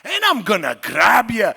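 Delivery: big, loud, high joyful dwarf bellow. Acted. Dwarf voice actor showing Bran's joy, booming. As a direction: grab life with both arms. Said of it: "it's an open-armed kind of character".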